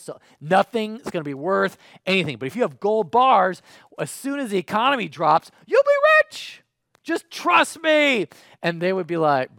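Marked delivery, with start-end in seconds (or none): 0.0-8.3 rising to a high, loud frantic pitch; hammed-up frantic salesman pushing gold; building into a squeaky shouted plea; mock urgent insistence. Mock prophet urging stock sale.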